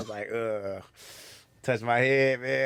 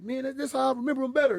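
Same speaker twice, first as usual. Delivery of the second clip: very high, defensive protest; high-pitched self-mocking excuse; making excuses; 13 semitones higher than usual. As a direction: plead your excuse in a high voice. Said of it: "I'm trying to make excuses".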